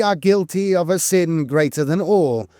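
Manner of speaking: ordinary talk, nothing marked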